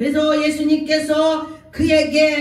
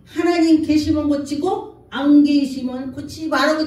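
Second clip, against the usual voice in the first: raised, forceful demand to God; defiant prayer; 3 semitones higher, 5 dB louder than usual. Retold or imitated. Unbeliever daring God to heal him, defiant raised voice. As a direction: push it out loud like a challenge.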